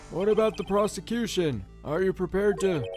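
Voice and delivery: judge voice. Acted. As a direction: ask it like a fussy judge.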